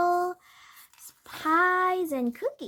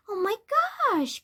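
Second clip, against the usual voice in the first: high-pitched, delighted exclamation; girlish excitement, pitch leaping up; excited cry; 8 semitones higher than usual. A narrator's reading. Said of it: "cried Heidi".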